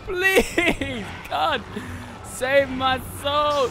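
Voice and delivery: loud, high frantic begging; desperate pleading. Real, not acted. Pleading with God as the monster attacks. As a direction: beg for your life.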